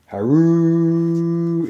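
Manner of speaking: long high horn-like call; war horn cry. A narrator's reading. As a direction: sound it like a war horn blowing. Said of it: "it cried".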